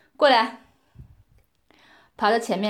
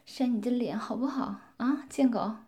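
usual speaking voice

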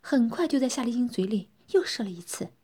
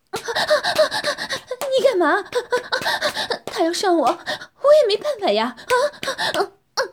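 much higher, louder moaning speech; 12 semitones higher, 7 dB louder, faster than usual. Acted. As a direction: moan and protest at once. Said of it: "夏丽晶呻吟着说". (呻吟着说)

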